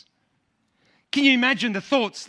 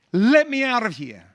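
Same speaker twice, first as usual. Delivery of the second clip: retold scream; 4 dB louder than usual. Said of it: "he was screaming".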